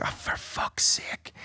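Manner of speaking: slow, whispered curse; whispered frustration. Retold or imitated. Hearing his friend must leave, a whispered slow curse. Frustrated aside in a retold scene.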